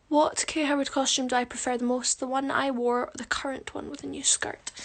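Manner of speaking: usual speaking voice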